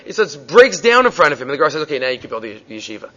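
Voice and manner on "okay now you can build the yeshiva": much lower and softer, quickly granted; the old sage gently gives permission; calm approval. Retold or imitated. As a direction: wise teacher quietly telling his student yes. The Gra calmly relents.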